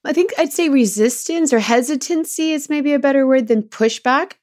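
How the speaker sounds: usual speaking voice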